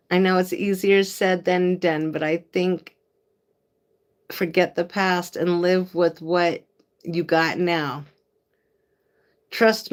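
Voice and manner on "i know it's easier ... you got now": ordinary talk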